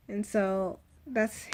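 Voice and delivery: ordinary delivery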